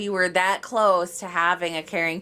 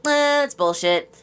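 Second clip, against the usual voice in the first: scoffing dismissal; 6 semitones higher, 4 dB louder than usual. Retold or imitated. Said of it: "And we're like".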